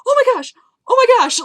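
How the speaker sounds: freaked-out excitement. Retold or imitated. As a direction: gush it out in a breathless thrill. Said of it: "she freaked out".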